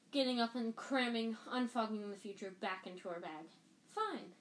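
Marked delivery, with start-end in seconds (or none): 3.9-4.3 much higher, clipped-off fine; sharper fine. A narrator's reading. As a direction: snap Fine up high. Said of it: "she repeated".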